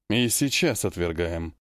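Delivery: low, restrained cold retort; cold reply. A narrator's reading. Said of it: "холодно ответил Велисарий". (холодный ответ низким голосом)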